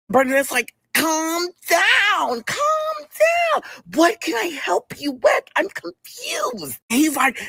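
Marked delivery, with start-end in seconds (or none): 0.9-6.8 very high, drawn-out coworker impression; put-on Bernadette voice. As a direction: stretch it out slowly in a squeaky-high mock voice. Bernadette's calming reply acted out.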